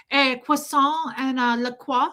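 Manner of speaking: mock pretentious French accent; affected French accent. Host mocking Americans in a French bakery, affected French accent. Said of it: "go into a French bakery here in America".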